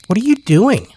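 put-on high, sharp child's voice; a small child calling out suddenly; child's demand. Child surprises woodcutter, high sudden question. Child startles narrator in forest.

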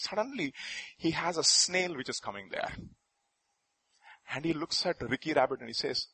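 normal talking voice

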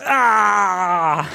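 high, drawn-out pained scream. A narrator's reading. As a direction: scream as your back is broken. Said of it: "I screamed".

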